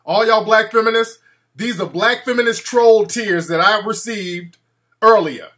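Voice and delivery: a vampire gloating over the tears of the trolls. Pretend. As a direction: relish the collected tears out loud. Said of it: "I'm like".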